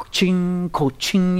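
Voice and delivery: high ringing cash-register "ching"; imitated vending-machine ching. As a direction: mimic coins dropping into the machine.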